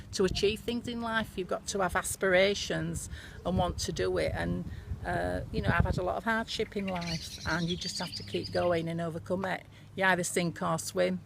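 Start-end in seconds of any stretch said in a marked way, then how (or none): none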